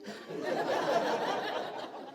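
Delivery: high breathy chuckle; breathy laugh. Real, not acted. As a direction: laugh at the memory. Laugh after the excuse.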